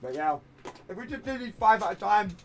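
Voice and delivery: ordinary delivery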